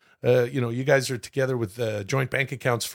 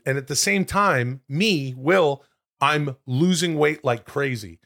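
ordinary talk

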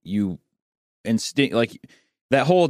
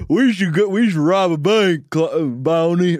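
dopey, higher, louder dim-witted voice; bumbling goon; 5 semitones higher, 4 dB louder than usual. Retold or imitated. Clyde dimly suggesting a bank robbery to Bonnie, in a dopey voice. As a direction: play it like a clueless gangster. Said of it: "they'd just be like".

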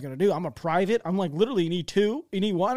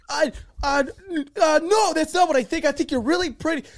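high-pitched, stammering mock panic; stumble, then blurt a desperate reassurance; flustered backpedalling; 9 semitones higher, 4 dB louder than usual. Cornered private answering the LT's sister, flustered.

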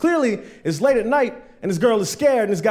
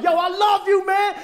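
happy shout. Retold or imitated. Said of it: "I was like".

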